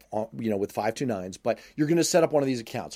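ordinary delivery

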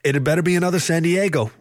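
high, happy character voice; cheerful, high-pitched teammate. A narrator's reading. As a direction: pipe up happily, well above the narration. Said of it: "Richard said happily".

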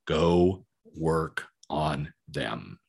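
low, very slow, word by word; slow, emphatic advice. Real, not acted. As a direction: let each word land on its own.

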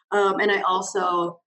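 normal talking voice

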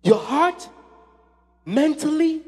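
high, loud sermon delivery; full-force preaching. Real, not acted. Preacher urging the congregation to love God with might, full-force preaching. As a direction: drive it out to the congregation, building to shouts.

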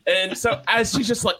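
ordinary talk, nothing marked

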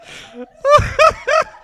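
high shrieking laugh; real laughter; loud helpless laugh. Real, not acted. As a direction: burst out laughing.

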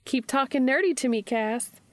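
high-pitched teasing; Charlie. A narrator's reading. Charlie teases Cass about his nerdy talk, high teasing voice. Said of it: "she teases".

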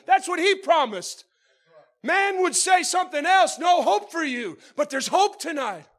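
loud, high, fervent preaching; shouting preacher. Acted. Preacher rousing the church, loud and high. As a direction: lift the whole room with a shout.

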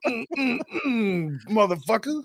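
high grunting strain; straining flex. Pretend. Friend acting out a Hulk Hogan flex, straining. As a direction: grunt like tearing a shirt in half. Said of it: "You should be like".

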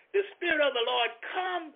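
high voice breaking with a real cry; overcome, crying out the Lord's presence; moved to tears. Real, not acted. Praise song under the tent.

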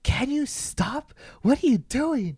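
high, drawn-out mock exasperation; imitated annoyance. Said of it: "she was getting annoyed".

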